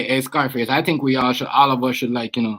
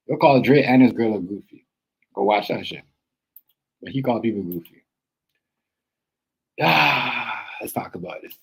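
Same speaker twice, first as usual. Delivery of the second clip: usual speaking voice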